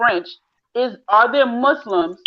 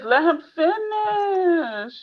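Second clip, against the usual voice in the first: pleading cry; 8 semitones higher, much slower than usual. Real, not acted. Exasperated at the interrupting man.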